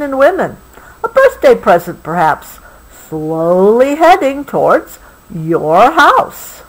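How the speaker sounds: lively storybook reading, slowing at the ends; story reading. A narrator's reading. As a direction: paint the night town for a child. Reading book text.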